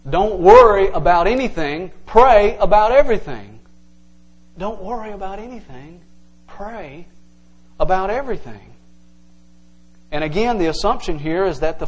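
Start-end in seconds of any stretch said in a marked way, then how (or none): 0.1-3.6 louder, proclaiming the verse; emphatic reading. Shown as a demonstration. Preacher proclaiming the verse to the church, emphatic. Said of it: "it would say something like this". 4.6-8.7 much softer, gentle, with pauses; soft reading. Shown as a demonstration. Preacher softly rereading the verse to the congregation, hushed. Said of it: "it would say something like this".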